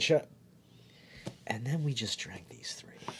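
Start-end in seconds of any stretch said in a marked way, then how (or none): 1.5-3.1 whispering. Real, not acted. Punchline aside.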